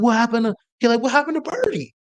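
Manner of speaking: high mock voice of a dazed linebacker; imitating the linebacker. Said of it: "He's like".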